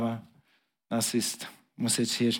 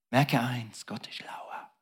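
hushed secret, breath only; whisper; 4 semitones lower, 8 dB quieter, slower than usual. Acted. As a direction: lean in and whisper it. Preacher drops to a whisper.

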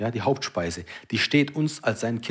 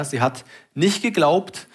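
ordinary talk, nothing marked

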